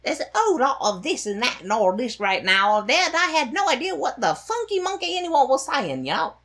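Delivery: high, loud mock Cockney street chatter; hammed-up London Cockney accent. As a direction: rattle it off in an over-the-top Cockney accent. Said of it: "the opening dialogue".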